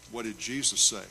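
ordinary delivery

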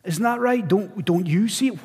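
ordinary delivery

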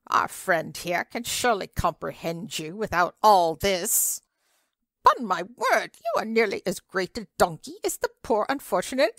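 a lightly voiced fussy old lady; scolding old lady. Old lady tells off the man who spoilt her brocade, lightly voiced.

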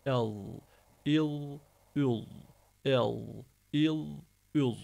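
"El, il, ül" with thick held lateral endings; side-tooth Dad. Shown as a demonstration. Teacher drilling Dad, heavy "El, il, ül". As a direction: drag each ending against the side teeth. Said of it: "Yan dişimize değdireceğiz".

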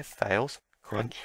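ordinary talk, nothing marked

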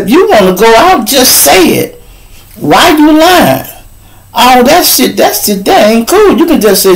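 high, indignant scolding; heated confrontation. Retold or imitated. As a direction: call her out, voice up high and hurt. Confronting girlfriend about lying.

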